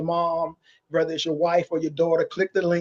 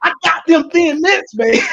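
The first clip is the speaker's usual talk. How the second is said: street hustler voice; 12 semitones higher, 4 dB louder than usual. Pretend. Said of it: "it's like I'm peddling stuff".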